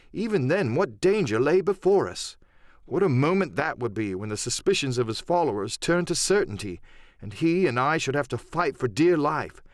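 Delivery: plain reading voice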